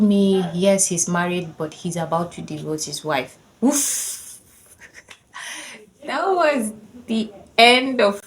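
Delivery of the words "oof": normal talking voice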